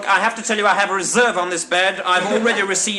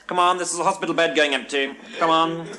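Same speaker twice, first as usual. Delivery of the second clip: normal talking voice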